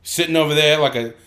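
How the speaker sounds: brash mocking Bostonian; Boston accent. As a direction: heckle in a broad Boston accent. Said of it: "do it with the Boston accent".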